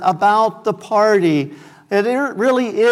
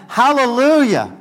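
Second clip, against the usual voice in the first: raised, higher Hallelujah as the crowd cries out; voicing the multitude; 4 semitones higher than usual. Preacher voicing heaven's crowd, raised and higher. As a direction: lift it like a shout of praise. Elders and creatures worship.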